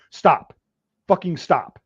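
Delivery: loud first word, then easing off; retold sharp command to halt; firm halting order. Soldier halting a trainee at the selector switch, retold firm order.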